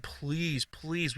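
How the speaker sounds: soft pleading please; boy pleading with the pediatrician, softly; childish begging. Retold or imitated. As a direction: beg quietly like a kid. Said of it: "I would beg the pediatrician".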